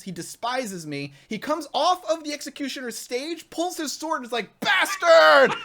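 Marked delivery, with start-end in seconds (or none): 4.6-5.5 furious shout of Bastard, much higher and louder; laughing through a mock battle cry; angry yell. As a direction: bellow it like an enraged executioner charging. Executioner charges, yelling at me.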